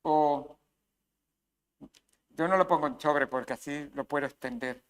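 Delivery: ordinary delivery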